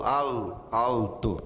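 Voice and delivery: "alto" com o L bem marcado, à grega; alto com L grego. Shown as a demonstration. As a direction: diga "alto" com L claro, sem u. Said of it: "no grego seria alto". (pronúncia grega: L firme em "alto")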